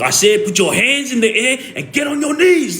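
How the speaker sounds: high mock scream; imitated police screaming. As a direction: re-enact an officer screaming orders. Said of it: "he was screaming so loud at me".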